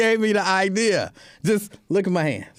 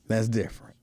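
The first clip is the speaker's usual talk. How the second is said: softer, near-whispered repeat; hushed aside; 8 semitones lower, 6 dB quieter than usual. Real, not acted. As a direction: drop it to an under-the-breath excuse. Muttered repeat of his excuse.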